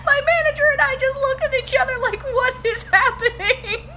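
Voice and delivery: high, squeaky talking through laughter; real laughter. Real, not acted. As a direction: barely get it out for laughing. Laughing through the punch line.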